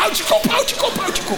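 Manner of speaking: high, urgent hushing; desperate to shut him up; panicked hush. Retold or imitated.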